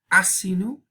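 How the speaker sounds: high, rising "Asinu", A-si-nu; stress on first A. Shown as a demonstration. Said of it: "el acento aquí sobre la A".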